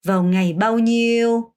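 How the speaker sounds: very slow and clear; teacher's careful phrase model; slow demo reading. Shown as a demonstration. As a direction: model each question slowly. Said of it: "같은 표현이에요".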